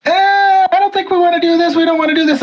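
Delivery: high mock-timid reluctance; mocking reluctant crowd. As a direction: squeak out a hesitant no. Everybody voiced reluctantly resisting.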